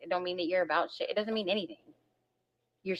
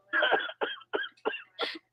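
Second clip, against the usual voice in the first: burst of laughter. Real, not acted. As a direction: break into laughter. Laughs at own insult.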